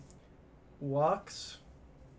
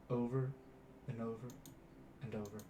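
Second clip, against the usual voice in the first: fading repetition; 4 semitones lower, 11 dB quieter, much faster than usual. Shown as a demonstration. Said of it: "repeated in repetition".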